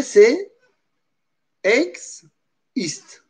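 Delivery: "ex, ist" broken into two separate syllables with a pause; syllable split demo. Shown as a demonstration.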